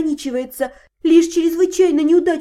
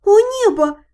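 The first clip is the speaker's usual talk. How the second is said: shocked gasp; 6 semitones higher, 4 dB louder than usual. Acted. Heroine shocked by revelation.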